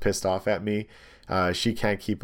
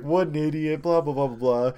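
higher, sing-song mock jeer; 7 semitones higher than usual. Laughing at the blonde woman's failed pass, mocking jeer. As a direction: toss off a scornful jeer at the driver.

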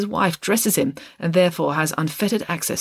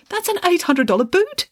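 much higher, aghast cry; horrified exclamation; 3 dB louder than usual. A narrator's reading. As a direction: gasp in horror at the ruined boot. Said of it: "exclaimed the secretary".

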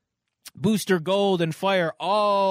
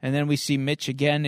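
ordinary talk, nothing marked

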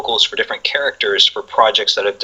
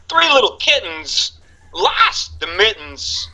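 much higher and slow, with odd pauses; Walken reading a nursery rhyme; 10 semitones higher, slower than usual. Retold or imitated. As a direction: read the rhyme like Walken, very slowly. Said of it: "he's like".

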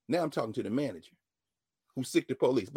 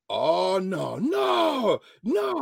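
high, loud cry of no, no, no; shouted protest; 7 semitones higher, 5 dB louder, much slower than usual. Retold or imitated. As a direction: call out in alarm across the room. Man shouting across restaurant.

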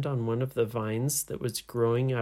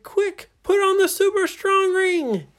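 very high, loud command; frantic storybook-hero holler; urgent shouting; 7 dB louder than usual. Acted. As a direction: yell instructions to a friend in danger. Hero shouts urgent order to friend.

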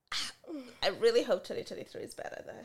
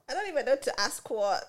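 ordinary delivery

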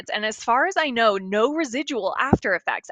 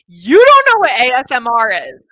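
incredulous outburst; 11 dB louder than usual. Real, not acted. Host shocked that her co-host doesn't know A S M R, incredulous outburst. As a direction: blurt it out much louder, astonished.

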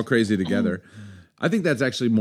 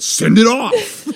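high, loud, drawn-out retold dare; defiant yell; 10 semitones higher, 7 dB louder, much slower than usual. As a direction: belt it out slowly, calling the bluff. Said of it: "to which I said".

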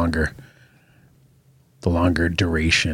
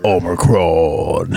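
slow, drawn-out robot voice; booming movie robot, stretched out slow; Optimus Prime voice; 3 semitones lower, much slower than usual. Pretend. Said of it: "Optimus Prime or whatever".

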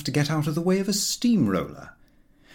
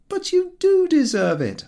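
higher, insisting, lightly voiced; 5 dB louder than usual. A narrator's reading. Narrator insisting to Harris, lightly higher. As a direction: press the point a touch higher. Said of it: "I insisted".

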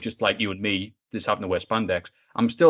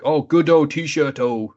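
mock Spanish accent; 4 semitones higher, 4 dB louder, much slower than usual. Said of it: "pretend Spanish".

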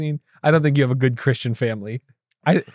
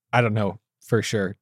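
ordinary talk, nothing marked

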